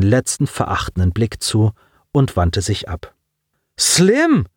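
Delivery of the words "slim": a high call after a friend who walks away; boy calling after his departing best friend. Acted. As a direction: call his name across the distance. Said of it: "rief Colin".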